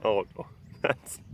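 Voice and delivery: ordinary talk, nothing marked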